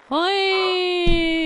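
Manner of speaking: drawn-out high "whee"; long squeal. Real, not acted. Player tumbling in the game, long squeal. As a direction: squeal and hold it.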